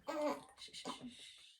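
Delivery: shushing. Real, not acted. Hushing baby.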